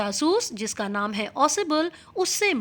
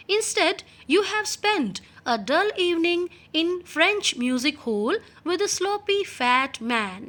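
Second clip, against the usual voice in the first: reading the English text slowly and clearly; 5 semitones higher, much slower than usual. Shown as a demonstration. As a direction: read each word out slowly for the class. Teacher reads English text aloud. (धीमी आवाज़ में पाठ पढ़ना)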